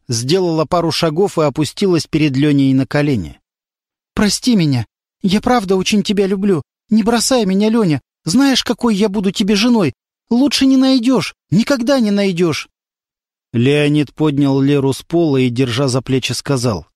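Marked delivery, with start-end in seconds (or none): 4.1-12.7 lightly voiced girl begging, higher; begging him not to leave; pleading girl. A narrator's reading. Girl begging on her knees. (Лера умоляет Лёню на коленях)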